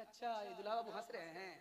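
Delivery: put-on quick, knowing repeat of the child's words; a father's light 'oh, is that so'; lightly voiced amused father; mild amused surprise.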